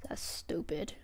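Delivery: soft unvoiced whisper. Real, not acted. Streamer annoyed at disconnects, whispering. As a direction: whisper it under your breath.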